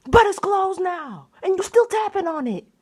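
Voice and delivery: exasperated scolding. Real, not acted. Scolding the tapping bird.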